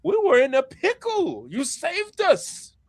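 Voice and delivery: very high, laughing cartoon voice; Brook. Pirate thanking his rescuers, high laughing character voice. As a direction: chuckle in high falsetto, then keep that voice. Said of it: "And Brook goes".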